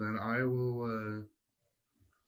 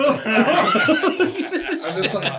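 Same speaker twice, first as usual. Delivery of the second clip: laughing apology; 12 semitones higher, 14 dB louder, much slower than usual. Retold or imitated. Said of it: "like, whispering to them".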